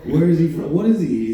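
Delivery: ordinary talk, nothing marked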